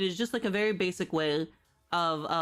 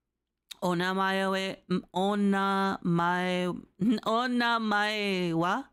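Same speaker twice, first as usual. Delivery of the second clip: slow demo repeat; 3 semitones higher, slower than usual. Shown as a demonstration. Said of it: "Listen to that again".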